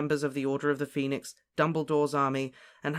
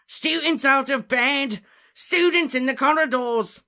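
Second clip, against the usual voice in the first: high, loud alarm call; aged caretaker's alarmed shout; 12 semitones higher, 9 dB louder, slower than usual. A narrator's reading. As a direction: yell it down the corridor.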